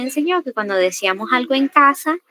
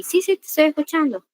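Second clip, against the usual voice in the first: higher-pitched, dismissive mock assurance; distracted listener; 6 semitones higher than usual. Shown as a demonstration. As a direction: pretend to listen while on the phone. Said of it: "No va usted a estar con el teléfono". (fingir que escucha, en tono más agudo)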